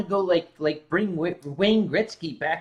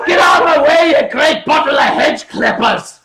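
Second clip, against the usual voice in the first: high, loud Glasgow-accent bellow; harsh Glaswegian shout; 7 semitones higher, 10 dB louder than usual. Shown as a demonstration. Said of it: "it's so harsh and so loud".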